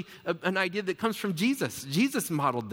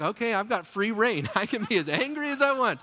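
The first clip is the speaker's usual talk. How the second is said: higher, gleeful voice with a laugh; mock glee; 5 semitones higher, 3 dB louder than usual. Joking as someone given free rein to be angry, gleeful. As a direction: playfully crow about the loophole.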